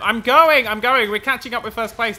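loud, high race cheering; excited. Real, not acted. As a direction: yell it as you pull ahead. Sprinting in a race game.

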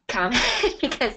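amused chuckle breaking into the words; laughing. Real, not acted. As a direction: let a real laugh slip out mid-sentence. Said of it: "I was laughing at your".

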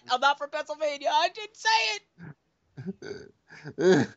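high, frantic shouting; shrill outraged shout. Acted. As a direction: yell it in mock panic. Mock outraged denial, shouting.

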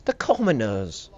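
slow, drawn-out British "commoners"; hammed-up British disdain; mock British accent. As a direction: stretch commoners like a snooty British aristocrat. Repeats word in mock British.

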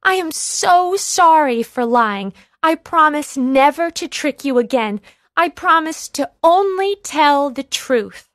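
high, loud pleading apology. Acted. Boy apologising to the villagers, high and loud. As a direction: beg forgiveness urgently. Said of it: "pleaded the boy".

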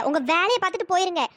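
ordinary talk

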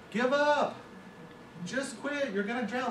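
high-pitched cartoon frogs telling him to quit; jeering frogs. Frogs on the roadside jeering at the frog in the bucket, high mocking voice. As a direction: taunt him in a squeaky frog voice. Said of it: "They're finally like".

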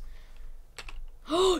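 high, breathy gasp of surprise; sudden excited gasp; sharp gasp. Real, not acted. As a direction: catch your breath in delight. Sees friend arrive in game.

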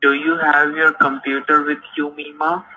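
ordinary talk, nothing marked

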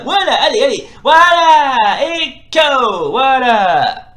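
loud, drawn-out victory cheer; triumphant cheering. Real, not acted. Gamer celebrating the KO of his opponent, loud triumphant cheer. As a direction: crow over the knockout. Winning the round by KO.

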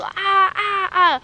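high, loud "ah" cries; short bursts of brain-freeze pain; imitated pain cry. Shown as a demonstration. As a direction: act out sharp yelps of pain. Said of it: "wo man macht so".

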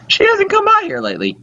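put-on very high old-lady voice; old mother. Mother asking son about Shirley, high old-lady voice.

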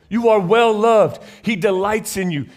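slow, loud heartfelt assurance; tender assurance. Real, not acted. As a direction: tell them slowly they are loved. Pastor assuring the congregation.

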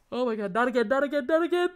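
loud, rising panicked repetition; alarmed dread. Real, not acted. Creepy bird appears on screen again.

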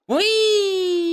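very high, loud squeal, held long; drawn-out falling-into-the-void squeal; long gleeful whee. Real, not acted. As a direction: let out a sustained high wheee. Jumping into the void.

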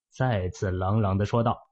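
ordinary delivery

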